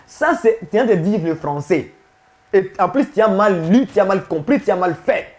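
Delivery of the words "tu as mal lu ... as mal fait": ordinary delivery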